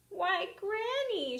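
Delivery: high, slow, wondering; surprised girl. Acted. Girl sees her changed Granny, surprised. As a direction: sound amazed at her.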